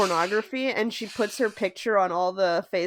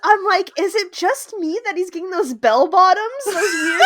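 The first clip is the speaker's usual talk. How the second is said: high, loud, incredulous agreement; excited, laughing; 8 semitones higher, 4 dB louder than usual. Real, not acted. Cohost relieved someone else noticed bell bottoms, laughing excitedly. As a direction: laugh through an exasperated rant, pitched up. Delighted agreement about the jeans.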